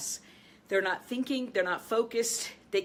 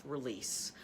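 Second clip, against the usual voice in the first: much lower and softer; soft command; 8 semitones lower, 10 dB quieter than usual. Shown as a demonstration. Coach showing a quiet self-command. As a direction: breathe the word out gently. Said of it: "just say to yourself".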